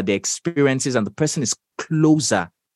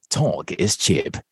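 low, slow voiceover read; 8 semitones lower than usual. Shown as a demonstration. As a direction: lower your voice and savor each word like a promo tag. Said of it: "give us a little bit of that award-winning flavor".

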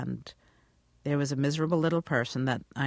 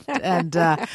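usual speaking voice

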